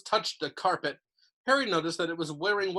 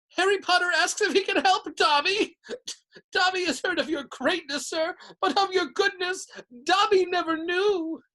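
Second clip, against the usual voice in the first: much higher, louder, laughing elf; overjoyed house elf; 13 semitones higher, 5 dB louder than usual. A narrator's reading. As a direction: burst out with grateful wonder. Dobby overcome with gratitude.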